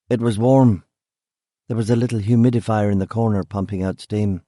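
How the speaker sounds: plain reading voice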